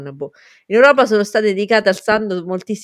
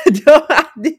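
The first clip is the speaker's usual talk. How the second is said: laughing; 8 semitones higher, 6 dB louder than usual. Real, not acted. After laughing at own confusion.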